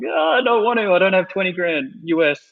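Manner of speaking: high, whiny mock protest; mock scared whine. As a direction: play it like a nervous kid pleading not to. His own scared reply on comms.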